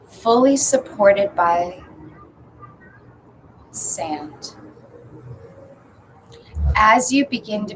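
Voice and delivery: measured meditation guide with long pauses; spacious, intoned visualization; guided meditation. Acted. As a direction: lead them gently through the imagery, phrase by phrase.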